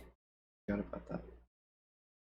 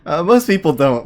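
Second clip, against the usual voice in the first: overly formal scientist; 6 semitones higher, 12 dB louder than usual. Acted. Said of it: "overly formal".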